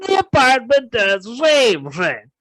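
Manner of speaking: Ray Romano murderer, high and loud; Ray Romano impression. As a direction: whine it like Ray Romano confessing. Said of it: "Who knew you had a Ray Romano in you".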